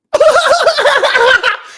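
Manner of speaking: big high laugh, loud; high cackling laugh. Retold or imitated. Woman laughing at his pickup line, loud high cackle. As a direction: burst out cackling.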